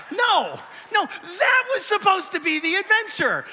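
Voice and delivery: high, loud exasperated mock shout; hammed-up indignant protest; mock outburst. A husband protests to his wife about the muddy hike, high mock shout. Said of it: "I'm like".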